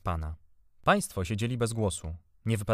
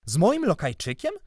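irritated; 2 semitones higher, 4 dB louder than usual. A narrator's reading. Uncle hearing of the boy and his servant, irritated. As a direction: stretch it out in growing indignation. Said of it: "Zirytował się".

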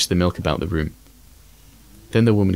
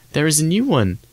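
put-on much higher, excited little child's voice; youngest child crying out; 9 semitones higher than usual. A narrator's reading. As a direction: call out the news like a small child. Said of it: "cried the youngest".

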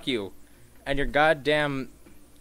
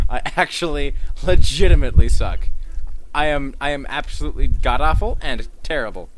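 loud self-mockery; 8 dB louder than usual. Real, not acted. Gamer ranting about sucking.

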